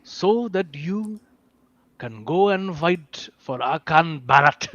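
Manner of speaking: mock guru with an Indian accent; Osho. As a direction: speak with slow, halting Indian-accented solemnity. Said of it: "my inspiration is Osho".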